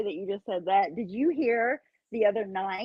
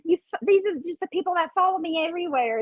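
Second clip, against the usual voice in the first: mocking whiny imitation; 9 semitones higher than usual.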